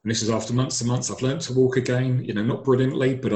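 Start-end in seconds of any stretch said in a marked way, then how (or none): none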